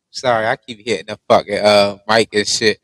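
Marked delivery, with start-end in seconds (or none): none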